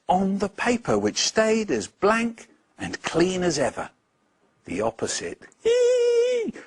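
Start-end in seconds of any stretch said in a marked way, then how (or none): 5.6-6.5 a tiny squeaky creature giggle, very high. Acted. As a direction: giggle mischievously from hiding. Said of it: "giggled from under the teacher's desk".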